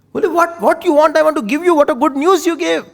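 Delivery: high, loud, eager gushing; overjoyed. Acted. Kekai gushes to Manthara over the good news, high and loud. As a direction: gush and offer a gift.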